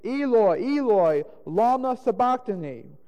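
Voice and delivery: high, loud cry to God. A narrator's reading. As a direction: call out loudly in appeal. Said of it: "cried with a loud voice".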